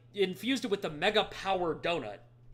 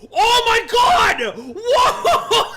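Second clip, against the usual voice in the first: excited shout; 12 dB louder, much slower than usual. Real, not acted. Amazed at game scene, laughing.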